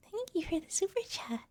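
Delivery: high, light idol thank-you; mock-cutesy singsong; cute streamer. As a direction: chirp a token thanks in a put-on streamer voice. Mimics streamer thanking a donor.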